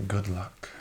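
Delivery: ordinary delivery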